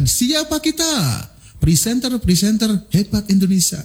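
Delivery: high rallying call and chant; energetic yel-yel. Shown as a demonstration. Trainer demonstrating a yel-yel for presenters, high chant. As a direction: fire up the room, call and answer. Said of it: "Contoh misalkan".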